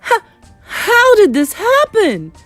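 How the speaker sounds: high, loud, drawn-out wail; stutter, then wail in dismay; aghast stammer. Acted. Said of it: "had no wind left in his sails".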